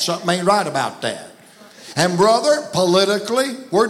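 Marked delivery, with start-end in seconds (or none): 0.0-1.3 quick, low Southern folk phrase; Southern saying again. Shown as a demonstration. Preacher repeating the phrase for the crowd, in a quick Southern way. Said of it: "I didn't say that, I said that".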